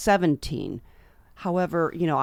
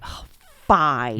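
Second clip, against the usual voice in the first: mock giving in, low and loud on "fine"; retold reluctant surrender; 5 semitones lower, 5 dB louder than usual. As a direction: act out caving in after being put on the spot. Acts giving in when put on spot.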